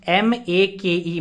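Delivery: "M A के ई" letter by letter, slowly; teacher spelling out a word slowly. Shown as a demonstration. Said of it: "देखो, make".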